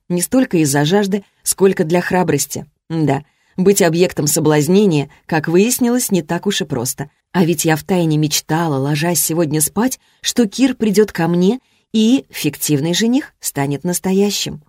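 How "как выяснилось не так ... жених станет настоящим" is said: plain reading voice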